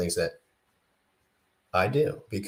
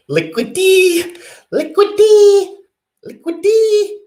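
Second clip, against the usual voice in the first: the word Liquidity sung out very high, loud and slow like a bird; high, loud squawking bird call on each Liquidity; 12 dB louder than usual. Pretend. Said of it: "It's like a bird call".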